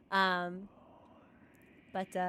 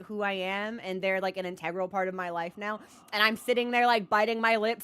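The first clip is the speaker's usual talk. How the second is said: ordinary talk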